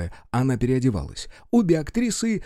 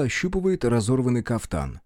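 ordinary talk, nothing marked

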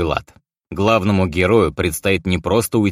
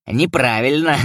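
chuckling; 6 semitones higher than usual. A narrator's reading. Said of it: "хохотнул Илья".

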